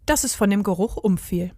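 ordinary delivery, nothing marked

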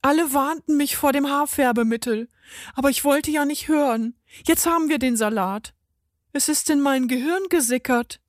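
put-on fretful old customer, pitched well up; old lady, alarmed. Lady fears hair dye reached her brain, high-voiced lament.

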